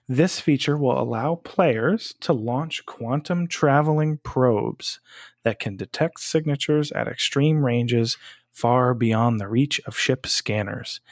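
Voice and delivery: ordinary talk, nothing marked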